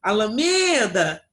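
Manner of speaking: loud, drawn-out call of "Alameda", stretched on "me"; shouted name-call. Shown as a demonstration. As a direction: call "Alameda" like someone's name, leaning long on "me". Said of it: "Como se a gente estivesse chamando o nome de uma pessoa".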